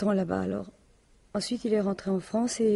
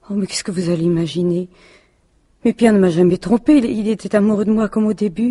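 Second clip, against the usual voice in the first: loud, offended denial; a wife loudly defending her dead husband; indignant outburst; 8 dB louder than usual. Acted. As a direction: fire back, much louder, defending him. Inspector hints husband cheated.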